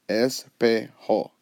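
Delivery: "espejo" in three slow, separate syllables. Shown as a demonstration. Teacher counting out syllables, "espejo" slowly divided. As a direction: break "espejo" into es, pe, jo. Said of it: "if you count it out".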